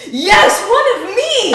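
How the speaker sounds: loud, high-pitched whoop of recognition; hammed-up ecstatic yell; overjoyed cheer. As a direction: shout it like you found your own kind. Retells joy at meeting fellow Californian.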